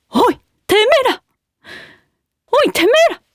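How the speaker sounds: high, shouted tough-teacher voice; Yankumi impression. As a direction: bark it like a delinquent-school teacher. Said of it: "じゃあ行きます。". (ヤンクミのモノマネ、高く叫ぶ)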